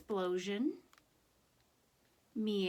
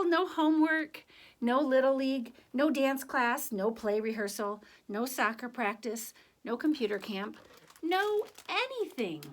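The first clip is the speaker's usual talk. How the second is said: put-on lively, higher read-aloud voice for children; story narration; 4 semitones higher, 4 dB louder than usual. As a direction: tell it to the kids with a sense of wonder. Reads picture book to class.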